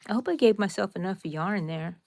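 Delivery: normal talking voice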